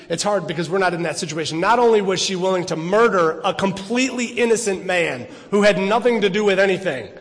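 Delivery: ordinary talk, nothing marked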